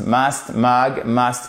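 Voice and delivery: "Must" and "mug" both with a short open u like uh; model words. Shown as a demonstration. Teacher demonstrates the vowel to learners, clearly. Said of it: "même prononciation pour la lettre U".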